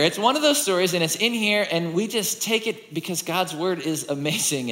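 ordinary talk, nothing marked

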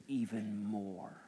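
very low, soft and slow; imitated divine whisper. Holy Spirit reassuring a doubting father, low hushed whisper. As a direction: murmur it deep and unhurried. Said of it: "Holy Spirit whisper to me".

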